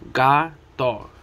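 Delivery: Gato broken into GA, pause, TO; split into syllables. Shown as a demonstration. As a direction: say Gato one syllable at a time. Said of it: "each segment that is broken up".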